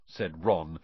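plain reading voice

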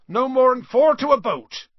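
high, very loud instruction shout; calling out. A narrator's reading. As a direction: bellow orders across the water. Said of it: "Hagrid called".

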